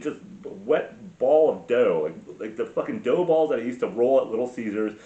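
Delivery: ordinary delivery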